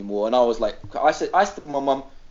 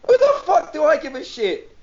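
exasperated; 12 semitones higher, 3 dB louder than usual. Retold or imitated. Mocking driver's excuse.

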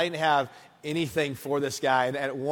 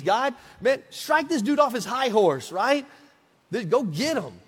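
much higher, eager pleading to God; retold fervent prayer; 6 semitones higher, 3 dB louder than usual. Young student praying against the law student, fervent. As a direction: beg God to knock him down. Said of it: "hit my knees".

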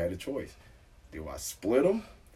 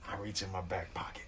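sneaky whisper; 3 semitones lower, 12 dB quieter than usual. Retold or imitated. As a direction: whisper it like you're hiding something. Sneaking phone in cop car.

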